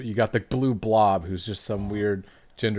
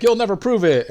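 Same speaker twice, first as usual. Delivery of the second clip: higher, louder mock villain sneer; defiant; 11 semitones higher, 8 dB louder than usual. Villain taunting the heroes, mock defiance. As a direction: hurl it as a cartoon villain. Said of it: "he's like".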